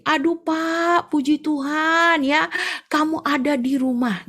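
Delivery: high, slow relieved voice; relieved neighbour. Acted. Neighbour relieved to find him home, slow relieved voice. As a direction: sigh out your relief slowly. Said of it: "dia bilang gini".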